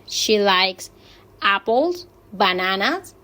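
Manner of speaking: "apples" and "bananas" each lifted at the end; rising list intonation. Shown as a demonstration. Teacher showing mixed intonation, each listed fruit rising. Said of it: "sube la entonación".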